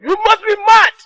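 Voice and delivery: furious shout. Acted. King outraged at son.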